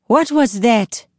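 high, sharp demand; tense young woman. A narrator's reading. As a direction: demand it, alarmed. Kaya confronts him, sword drawn.

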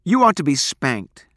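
loud, high scolding; angry. A narrator's reading. Girl scolding her boyfriend, angry. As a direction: scold him hotly. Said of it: "Abra's face reddened with anger".

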